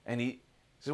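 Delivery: ordinary delivery, nothing marked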